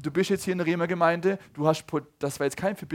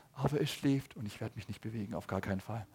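softer and lower so as not to wake him; hushed resolve; 6 semitones lower, 9 dB quieter than usual. Retold or imitated. Parent holds still for the sleeping baby, hushed. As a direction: keep it hushed, determined not to move. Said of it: "Und du denkst".

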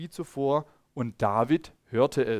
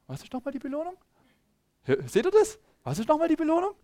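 put-on much higher, keen boyish voice; eager young David; 12 semitones higher, faster than usual. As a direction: ask it like an innocent, eager boy. Young David asks about reward. (hohe, eifrige Jungenstimme)

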